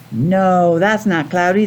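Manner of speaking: ordinary talk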